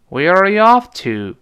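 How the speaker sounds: WHERE ARE YOU OFF climbs loudly, "to" drops; loud rise and fall. Shown as a demonstration. Teacher leads students aloud in intonation practice, rising then falling. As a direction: call it out, soaring to OFF and falling on "to". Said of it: "再来一遍".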